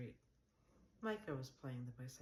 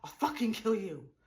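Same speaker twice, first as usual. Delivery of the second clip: much louder and higher, a raging yell; yelling threat; 8 semitones higher, 13 dB louder than usual. Acted. Neighbor on the balcony yelling death threats at Lena. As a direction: scream the threat like a madman. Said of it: "he was yelling".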